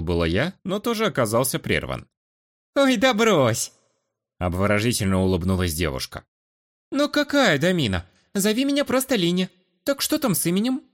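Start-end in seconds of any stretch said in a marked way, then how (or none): 2.8-3.7 playful dismissal. 6.9-10.8 put-on high, coquettish girlish voice.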